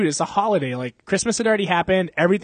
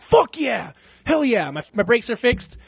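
excited cheer; 7 semitones higher, slower than usual. Retold or imitated. Brakes fixed for free.

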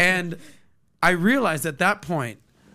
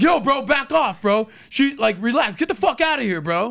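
high, louder mock bro bravado; tough guy; 6 semitones higher, 4 dB louder than usual. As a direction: ham up defending her. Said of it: "then a guy's like".

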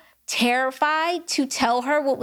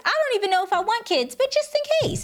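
put-on higher, quick, bubbly girl talk; excited retelling; 6 semitones higher, faster than usual. Bragging to a friend about her pamphlet, in a high, excited rush. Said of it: "I said".

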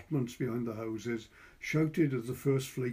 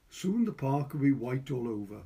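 usual speaking voice

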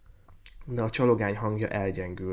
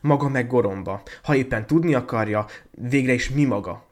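loud, higher, haughty comeback; indignant retort; 4 semitones higher, 8 dB louder than usual. A narrator's reading. Girl snubs the poor student, indignantly. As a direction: hit back in raised voice.

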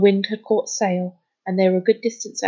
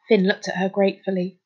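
usual speaking voice

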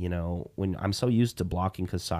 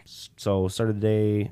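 ordinary delivery

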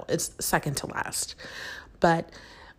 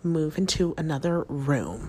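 ordinary talk

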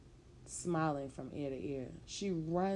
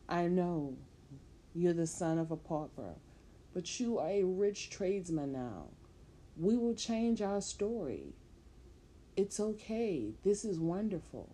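eager reassurance; 3 semitones higher than usual. Acted. Woman soothing her angry lover, raised and eager. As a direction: sell him the good news.

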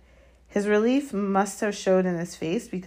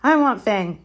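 loud, higher, hasty claim; quick demand; 6 semitones higher, 9 dB louder than usual. A narrator's reading. Malfoy claiming the dog for protection, quickly. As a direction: jump in before anyone else. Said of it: "said Malfoy quickly".

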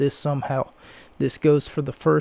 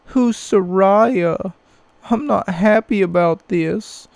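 high, whiny mock-sobbing complainer; hammed-up boo-hoo whining fan; fake crying; 6 semitones higher, 5 dB louder, slower than usual. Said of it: "all I keep seeing on Twitter is stuff like".

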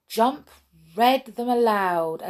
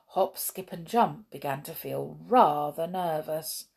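ordinary delivery

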